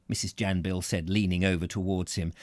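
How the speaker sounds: ordinary delivery, nothing marked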